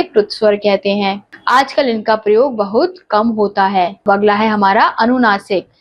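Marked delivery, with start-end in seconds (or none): none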